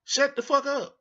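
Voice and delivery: high, sharp Shut the fuck up with punch on fuck; heated dismissal; fed-up outburst. Real, not acted. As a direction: bark it like you're done listening. Repeating his new catchphrase.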